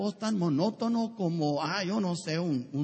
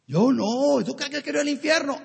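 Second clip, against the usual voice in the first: indignant denial; 6 semitones higher, 6 dB louder than usual. Retold or imitated. Voicing a self-righteous churchgoer.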